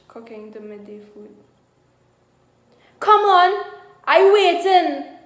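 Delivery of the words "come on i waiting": high, loud and slow angry yell; thundering. Acted. Husband thundering at his wife for food, loud and slow. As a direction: thunder it at her, dragging the words. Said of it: "thundered Gurudeva".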